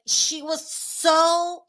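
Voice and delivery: usual speaking voice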